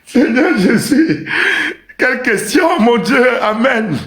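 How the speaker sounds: high-pitched cry to heaven; exclamation scandalisée. Real, not acted. Exclamation outrée devant une question. (cri aigu, prendre le Seigneur à témoin)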